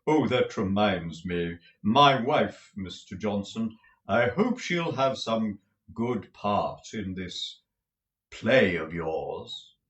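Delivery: slow, theatrical actor's voice; pompous tragedian. A narrator's reading. Lenville admiring Nicholas's room, slow and grand. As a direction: roll it out like a stage tragedian.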